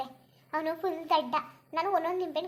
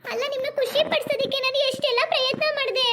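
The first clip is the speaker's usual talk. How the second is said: hammed-up whimpering daughter-in-law, higher; mock crying; 7 semitones higher, 5 dB louder than usual. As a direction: whine through fake tears. Scolded daughter-in-law mock weeps. (ನಾಟಕೀಯವಾಗಿ ಅಳುತ್ತಾ)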